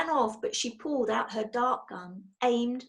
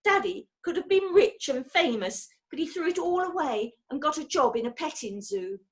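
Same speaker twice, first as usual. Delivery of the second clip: bitter spitting; 6 semitones higher than usual. A narrator's reading. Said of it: "She almost spat her last words".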